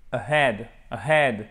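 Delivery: AHEAD opening on a weakened "uh"; schwa in AHEAD. Shown as a demonstration. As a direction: reduce the first A of AHEAD to schwa, stress HEAD. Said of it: "il est prononcé comme un E atténué".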